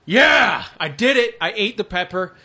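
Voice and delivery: loud, high victory shout; triumphant cheer. Real, not acted. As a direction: cheer like you just won. Triumph after finishing the pepper.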